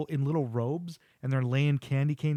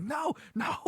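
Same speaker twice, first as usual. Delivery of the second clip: high-pitched mock panic; freaking out; 13 semitones higher than usual. Devil watching the naughty list grow, acted freak-out. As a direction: wail it in exaggerated alarm. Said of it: "the devil's freaking out".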